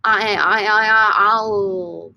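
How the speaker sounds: "A, e" repeated until it melts into a long "al"; vowels smushed together. Shown as a demonstration. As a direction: slur "A, e" into one drawn-out "al". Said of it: "They just make one whole new word".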